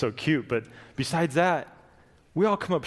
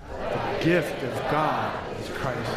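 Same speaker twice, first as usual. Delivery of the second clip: gentle contrast; much slower than usual. Shown as a demonstration. Congregation answering the verse, slow and gentle. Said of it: "like the host of angels, let's do it".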